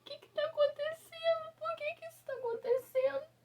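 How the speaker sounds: high, strained pleading to God; crying out in despair; a desperate question to God; anguished prayer. Retold or imitated. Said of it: "Eu lembro que eu falei assim".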